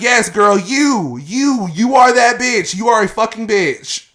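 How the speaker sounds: loud, high-pitched retold telling-off; angry confrontation. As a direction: call her out, hammering each 'you'. Retelling a confrontation with her neighbor.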